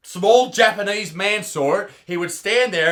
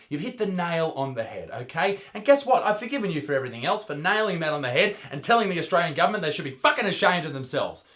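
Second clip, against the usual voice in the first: ordinary talk, nothing marked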